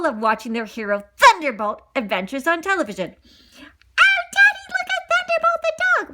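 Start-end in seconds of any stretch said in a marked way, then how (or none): 3.1-6.1 put-on very high excited child voice; little puppy calling to daddy; high squeaky puppy excitement.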